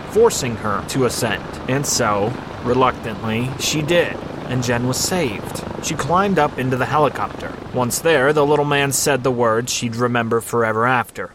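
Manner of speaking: usual speaking voice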